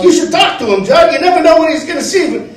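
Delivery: high, fast gangster-style mom; mom as forties gangster. Retold or imitated. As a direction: nag like a 1940s mobster mother. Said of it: "My mom talks like a forties gangster too".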